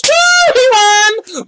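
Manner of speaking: high, loud whoop; cheer. Real, not acted. Thanking Tidal for free tickets, loud cheer. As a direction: cheer like you won.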